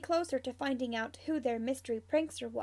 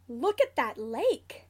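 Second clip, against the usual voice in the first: slow, louder wowed exclamation; awed excitement; 6 dB louder than usual. A narrator's reading. Sirius admires a house listing, awed. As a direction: marvel at it, stretching the words. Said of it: "Sirius gasps".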